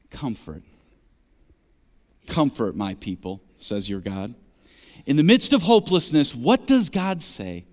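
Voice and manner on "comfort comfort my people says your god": soft, hushed and tender, much lower; tender comfort. Acted. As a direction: breathe the words like a gentle promise. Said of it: "Speak tenderly".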